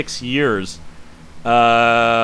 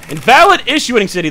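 exasperated outburst; 10 semitones higher, 7 dB louder than usual. Real, not acted. Error flagged on documents.

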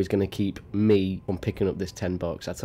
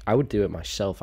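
ordinary talk, nothing marked

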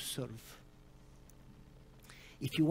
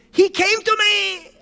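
high, loud, slow wonder; girl telling the preacher in joyful awe; 12 dB louder, slower than usual. Retold or imitated. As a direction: say it amazed and overjoyed. Girl overwhelmed with joy.

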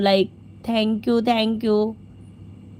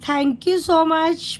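sing-song thanks; 7 semitones higher, much slower than usual. Acted. Thanking a donor, drawn out.

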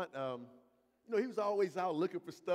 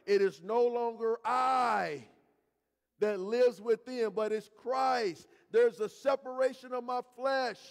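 preaching; 5 semitones higher, 6 dB louder than usual. Acted. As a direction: preach it out, drawn and loud. Preacher building at full force.

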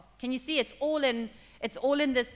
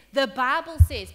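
loud, slow emphasis; 10 dB louder than usual. Acted. Jesus quoting scripture, emphatic.

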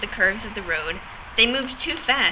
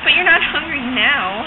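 confident; 4 semitones higher, 6 dB louder, slower than usual. A narrator's reading. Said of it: "I said confidently".

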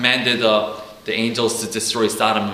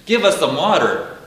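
higher, louder whiny voice of thirsty people; complaining Israelites; 8 semitones higher, 6 dB louder than usual. Retold or imitated. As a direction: whine at Moses like a thirsty crowd. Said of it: "they're always complaining to God".

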